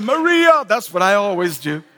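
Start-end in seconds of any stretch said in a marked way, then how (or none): 0.0-0.6 calling her name loudly across the room; shouted. Retold or imitated. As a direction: call out Maria from the crowd. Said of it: "Somebody over there shouted".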